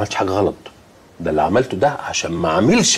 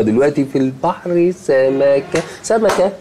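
singsong teasing; 5 semitones higher than usual. Acted. Father teasing with a silly rhyme.

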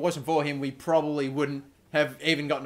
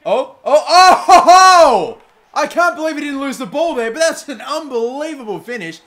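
loud, much higher, laughing whoops; excited goal yell; 10 semitones higher, 13 dB louder than usual. Real, not acted. Goal celebration in match.

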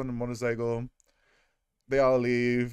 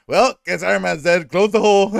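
louder and higher, brushing him off; mock careless shout; 5 semitones higher, 10 dB louder than usual. Heroes giving up on Iron Man, mock careless shout. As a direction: call it out like nobody cares. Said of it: "they're like".

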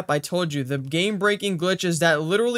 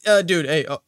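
ordinary delivery

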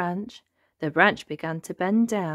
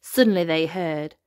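plain reading voice

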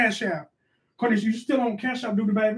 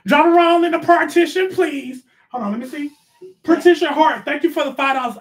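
hype announcement; 7 semitones higher, 5 dB louder than usual. Real, not acted. Hyping a donor announcement.

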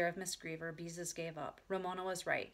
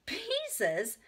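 very high, incredulous echo; surprised exclamation; 14 semitones higher, 4 dB louder than usual. A narrator's reading. Lady hears an odd nickname, high surprised exclamation. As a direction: repeat the name in high astonishment. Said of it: "exclaimed the lady".

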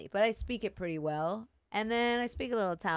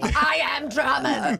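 slow, high, loud proclamation; mock German shout; 6 semitones higher, 6 dB louder, slower than usual. Guest topping the co-host's German act, mock shout. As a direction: bellow it like a hammed-up German.

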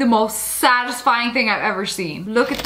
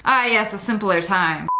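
normal talking voice

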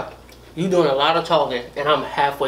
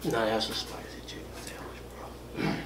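whispering; 2 semitones lower, 12 dB quieter than usual. Real, not acted. Whispering with a mouthful of food.

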